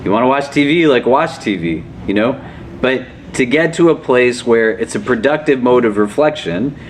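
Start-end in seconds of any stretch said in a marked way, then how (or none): none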